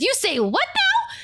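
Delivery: high, surprised question; startled bard Betsy. Acted. Bard hears her home turned to bagels, high surprised voice. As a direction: caught off guard by the news. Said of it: "she looks at Perry and she says".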